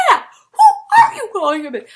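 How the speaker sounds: fired-up shout. Retold or imitated. Teen self joining the singers, laughing loud shout. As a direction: shout it back with thrilled defiance. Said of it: "I was like".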